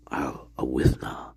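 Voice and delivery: hushed whisper greeting a viewer. Real, not acted. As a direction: breathe the name out in a hush.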